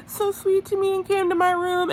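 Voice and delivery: high mock-sweet gushing; Teresa. Recapper mimicking a contestant telling her rival, high and gushy. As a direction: gush in a put-on high voice.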